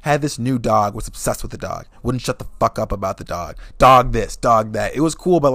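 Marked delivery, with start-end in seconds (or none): none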